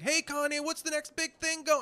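high, eager reporter pestering; mock paparazzo. Paparazzo pestering [name] for news, mock eagerness. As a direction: imitate a pushy photographer calling out.

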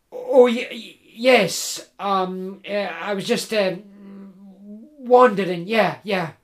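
nervous, halting, tripping on every no; flustered stammer. Acted. Jim nervously denying to the woodsman, stammering. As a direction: fumble for an excuse.

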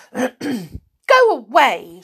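loud, drawn-out, high dismissal; angry. A narrator's reading. Connie to the saucepan man, loud and angry. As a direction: send him off crossly, slowly and loudly. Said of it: "she said angrily".

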